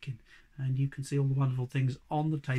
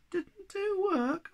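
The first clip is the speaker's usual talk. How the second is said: high, slow, disappointed squeak; little creature let down; much slower than usual. Acted. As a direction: squeak it out, crestfallen. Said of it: "asked Sniff in disappointment".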